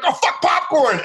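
very high, urgent cry; panicked shout. Retold or imitated. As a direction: yell it the moment you remember. Finally remembering the safe word.

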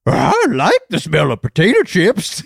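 a sweet odd guy confessing a small joy; cute character voice. Acted. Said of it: "I love this cute guy".

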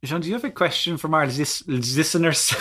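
put-on French accent. Cohost asks John for a listener question, mock French accent. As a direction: ask it in a bad French accent. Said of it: "have you heard our French accents?".

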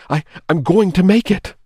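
fast, loud, high panicked wail. A narrator's reading. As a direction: babble it out in terror. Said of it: "he wailed".

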